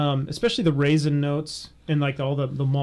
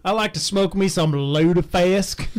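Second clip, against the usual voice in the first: mock Norwegian accent; 3 semitones higher than usual. Joking Norwegian impressions.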